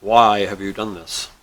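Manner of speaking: loud, slow, imposing genie voice; disturbed genie. Acted. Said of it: "the genie looks at him and says".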